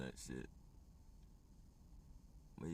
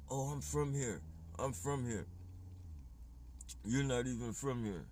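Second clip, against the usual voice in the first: mocking locals; 6 semitones higher than usual. Mimicking strangers who claim his recycling spot, in a high mocking whine. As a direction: parody their smug claim to belong. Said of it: "And then they'd be like".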